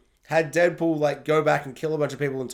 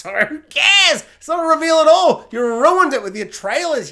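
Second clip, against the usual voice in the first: loud, high outburst; worked-up, high-pitched complaint; exasperated rant; 10 semitones higher, 9 dB louder than usual. Acted. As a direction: shout it in indignation. Mock rant at trailer spoiling cameo.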